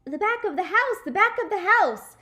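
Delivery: high, loud hollered echo; hollering kids. Acted. The class to the daddy, a loud holler. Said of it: "we hollered out".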